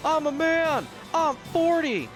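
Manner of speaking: high, loud impression of a ranting college coach; football coach rant. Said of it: "the college football coach".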